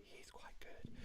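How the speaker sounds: hushed, voiceless whisper; whispered aside. Real, not acted. Quiz host to players about the musician, whispered aside. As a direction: slip it in under the breath.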